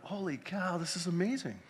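retold amazement, voice jumping up; excited. Retold excitement mid sermon.

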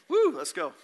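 loud, high whoop to rally the room; real burst of hype; excited whoop. Real, not acted. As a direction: whoop it up, then urge them on.

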